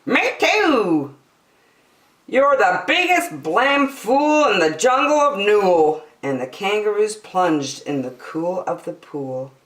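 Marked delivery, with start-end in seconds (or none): 0.0-6.0 put-on high, loud taunting child voice; high mocking little kangaroo; young kangaroo jeering. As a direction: jeer like a cheeky little kangaroo. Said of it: "the young kangaroo in her pouch said".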